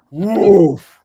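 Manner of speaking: loud, high whoosh of sudden wind; a sudden "woof" blast; imitated storm gust. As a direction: burst out with a mimicked gust.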